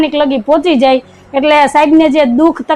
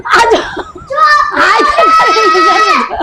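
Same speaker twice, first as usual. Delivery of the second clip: drawn-out exasperation; 5 semitones higher, much slower than usual. Real, not acted.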